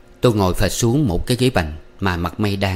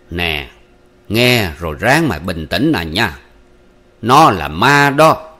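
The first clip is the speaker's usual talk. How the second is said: slow warning building to a loud, raised reveal; grave revelation; 5 dB louder, slower than usual. Acted. Uncle tells young man his sweetheart is a ghost, grave. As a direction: brace the listener, then land it heavily.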